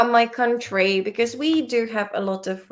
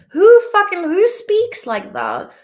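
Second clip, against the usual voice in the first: outraged outburst; 8 semitones higher, 8 dB louder than usual. Real, not acted. Ranting at the wordy definition.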